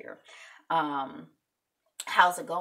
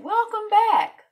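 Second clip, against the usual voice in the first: high, loud, slow welcome back; drawn-out cheerful greeting; big welcome; 14 dB louder, slower than usual. Real, not acted. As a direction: call it out to the crowd. Greeting returning viewers.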